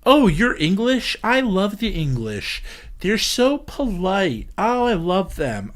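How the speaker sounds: high, gushing, slow delighted American; gushing American fan. Retold or imitated. Americans gushing to an English mom, high and delighted. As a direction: coo over an English mom like a starstruck fan. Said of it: "say to her".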